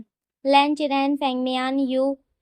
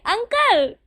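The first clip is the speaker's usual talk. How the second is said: high, loud startled shout; startled cry; 7 semitones higher, 4 dB louder, much slower than usual. Acted. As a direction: jump back and cry out. Both startled, cry out together.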